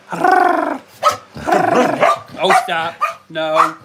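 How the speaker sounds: high loud yelp at the dogs; startled yelp. Real, not acted. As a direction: yelp to break them up. Dogs fighting, exclaims.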